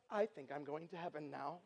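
low, very soft voice of dread; meek aside. Retold or imitated. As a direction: murmur it low, as if doomed. His reaction facing huge angry camper.

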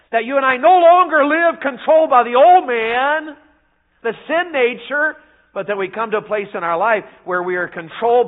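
rising, loud sermon delivery; fiery preaching. Acted. Preacher urging the congregation, loud and rising. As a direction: drive the point home to the pews.

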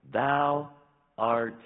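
Thou and art punched high and loud; emphatic scripture quote. Acted. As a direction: proclaim the verse, hitting each word.

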